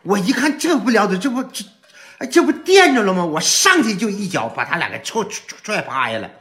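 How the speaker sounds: high, loud, stammering comic alarm; alarmed retelling. Retold or imitated. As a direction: relive the panic, tripping over words. Reliving a panicked mistaken rescue. (又高又急的惊慌讲述)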